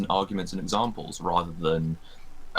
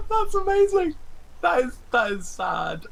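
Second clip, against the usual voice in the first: high squeal of laughter; laughing. Real, not acted. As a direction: laugh through the words, high-pitched. Mocking the pitiful commenter.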